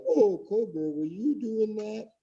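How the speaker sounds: high, soft, drawn-out mimicry; mock them in a high, slow coo; imitated high-pitched, hushed wonder; mocking imitation. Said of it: "you were like".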